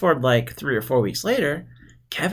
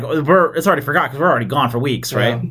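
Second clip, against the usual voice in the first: ordinary delivery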